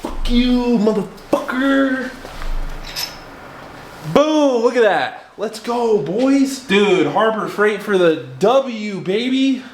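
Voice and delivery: high, slow triumphant bro gloating; frat bro hype. Real, not acted. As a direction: gloat over the beaten bolt. Bolt finally breaks loose.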